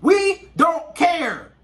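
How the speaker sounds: slow, loud "We don't care." with every word hit; emphatic shout. Real, not acted. Man stressing to the ladies watching, slow emphatic shout. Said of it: "I cannot stress that enough".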